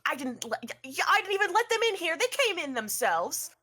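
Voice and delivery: high, fast protest; defensive shrug. Acted. Wayward shrugging at Avocado Squashadoo, high defensive protest. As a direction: blurt out the excuse with a shrug. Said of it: "Wayward shrugs and goes".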